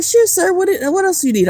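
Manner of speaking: high mock cashier voice. Cashier mistaking her for a man.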